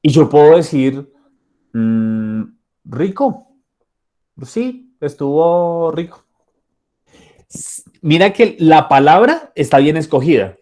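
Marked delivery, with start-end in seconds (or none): 1.7-6.2 a slow, reluctant "rico" that nobody believes; half-hearted compliment on the lunch; unconvinced praise. Shown as a demonstration. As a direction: say it's tasty while your tone says otherwise. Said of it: "tu corporalidad dice totalmente lo contrario".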